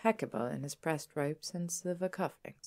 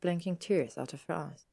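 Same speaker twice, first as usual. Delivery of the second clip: ordinary delivery, nothing marked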